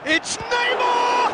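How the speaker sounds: long, stretched high shout of the name; excited call. Real, not acted. Commentator shouting the scorer's name, stretched excited call. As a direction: hold the name out like a roar. Commentator calls a goal chance.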